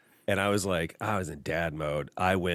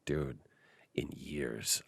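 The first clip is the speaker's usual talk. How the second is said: whisper; 6 semitones lower, 9 dB quieter, much slower than usual. Real, not acted. Awed whisper about thunder.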